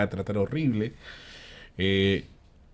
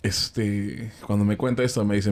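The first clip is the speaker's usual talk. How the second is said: normal talking voice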